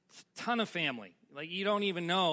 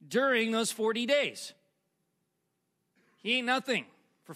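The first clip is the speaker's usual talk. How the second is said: emphatic reading in weighty, separated phrases; dramatic scripture reading; 3 semitones higher than usual. Acted. Preacher reading Luke to congregation, emphatic. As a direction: preach the passage with deliberate pauses.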